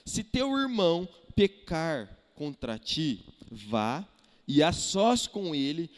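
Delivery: deliberate, emphatic Bible reading; solemn pulpit reading with weighty pauses; scripture reading. Acted. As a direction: proclaim the verse phrase by phrase. Reads scripture aloud.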